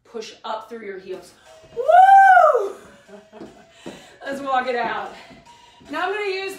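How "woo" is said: very high, loud whoop; cheering woo. Real, not acted. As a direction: whoop to celebrate. Cheer after the heel raises.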